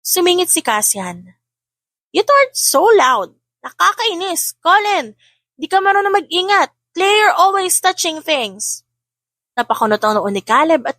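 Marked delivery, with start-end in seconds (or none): 2.1-8.8 put-on higher pitched bratty kid complaint; a cross older boy snapping at his siblings; annoyed child voice. A narrator's reading. Cassian scolding siblings: high, annoyed child voice.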